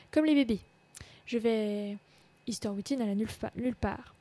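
lively read-aloud of a novel, voicing the girls lightly; story narration. A narrator's reading. (lecture vivante d'un roman à voix haute)

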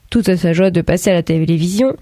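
usual speaking voice

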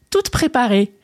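usual speaking voice

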